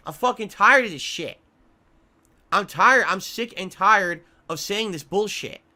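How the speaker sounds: loud, furious rant, real frustration; angry outburst. Real, not acted. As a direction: let it burst out, fed up. Ranting about tips and trolls.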